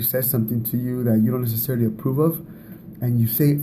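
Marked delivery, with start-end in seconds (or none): none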